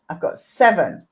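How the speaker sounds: normal talking voice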